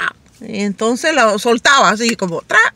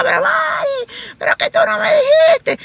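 mock angry complaint. Angry coworker scolding Mari over missed gossip. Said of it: "Enojadas y reclamando".

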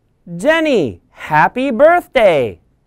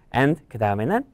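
ordinary delivery

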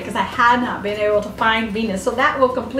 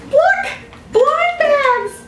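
excited shout; 12 semitones higher, much slower than usual. Real, not acted. Finding blind bags in the box, a high thrilled cry. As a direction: shout with delight at the find.

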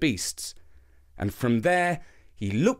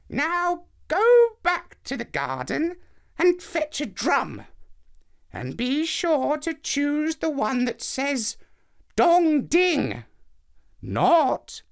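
put-on much higher slow old woman voice; old lady; 12 semitones higher, slower than usual. A narrator's reading. Old lady telling Anansi which drum to fetch, high and slow. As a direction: sing out the orders in a thin old voice.

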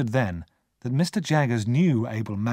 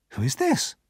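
mildly surprised question; a touch of surprise at the stranger; 6 semitones higher than usual. A narrator's reading. Herbert seeing the convict, mildly surprised. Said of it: "he asked in surprise".

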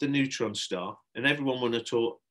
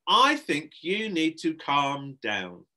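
slow, emphatic, higher warning; drawn-out, louder admonishment; 5 semitones higher, 6 dB louder, much slower than usual. Acted. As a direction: spell it out sternly to a child. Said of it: "a note of warning in her voice".

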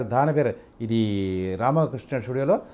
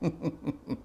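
mocking laugh; 15 dB quieter than usual. Shown as a demonstration. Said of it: "Ramarao గారిది దానవీర స్వర్గంలో dialogue".

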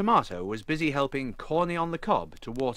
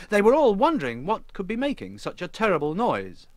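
ordinary delivery

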